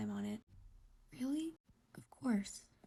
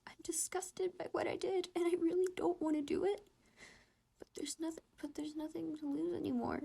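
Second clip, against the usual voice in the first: higher, breaking into tearful pleading; tearful, raised and breaking, voice cracking; crying; 5 semitones higher than usual. Acted. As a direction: confess it through tears. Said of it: "Both boys were crying by now".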